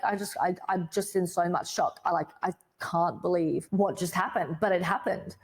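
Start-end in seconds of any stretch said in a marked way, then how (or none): none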